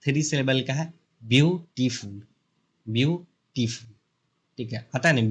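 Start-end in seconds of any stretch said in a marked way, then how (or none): 1.2-2.2 "beautiful" in three separate beats, view, टी, फुल; broken into syllables. Shown as a demonstration. Teacher showing three syllables to students, "beautiful" split up. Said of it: "ऐसे जो तोड़ते हो ना". 2.9-3.9 "beautiful" slowed into view, pause, टी, फुल; broken into syllables. Shown as a demonstration. Teacher repeating the three-syllable example, "beautiful" slowly split. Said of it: "ऐसे जो तोड़ते हो ना".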